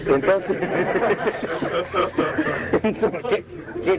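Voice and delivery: laughing. Real, not acted. Laughs at his own slip.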